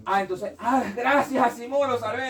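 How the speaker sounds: high, loud burst of gratitude; hammed-up delighted relief; mock relieved thanks. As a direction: act out a writer overjoyed at being rescued. Acts author thanking Asimov, saved.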